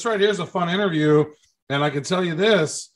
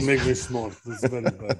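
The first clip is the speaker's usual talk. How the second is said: put-on Russian accent; 3 semitones lower, 3 dB quieter than usual. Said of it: "we make accent".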